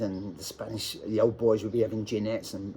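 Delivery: ordinary delivery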